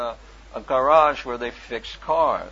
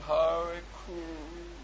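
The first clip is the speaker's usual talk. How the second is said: very slow, halting "Hare Krishna", softer; struggling attempt; 5 semitones higher, 4 dB quieter, much slower than usual. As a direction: stumble through the words. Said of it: "No, I can't say it".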